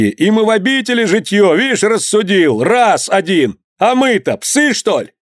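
high, indignant heckling; crowd outcries. A narrator's reading. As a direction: object scornfully from the mob. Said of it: "Слышались выкрики".

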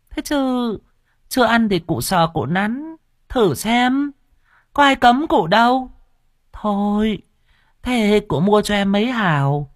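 very high, coy, teasing woman's voice; sing-song the come-on up high; flirty fruit seller. Acted. Seller teasing the old official, very high and coy.

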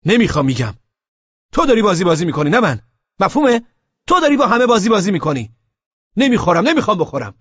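loud, high angry shout. A narrator's reading. Narrator yelling at Laleh that she is toying with everyone, loud and high. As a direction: yell it at her, fed up. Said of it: "داد زدم".

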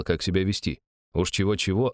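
ordinary delivery, nothing marked

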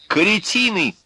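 high, indignant cry; insulting outburst. A narrator's reading. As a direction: hurl the insult at all of them. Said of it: "говорил Князев".

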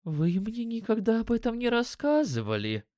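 high-pitched astonishment; surprised elf woman. A narrator's reading. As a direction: protest in a high, surprised character voice. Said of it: "Эльфийка удивлённо вскинула брови".

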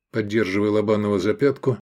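ordinary delivery

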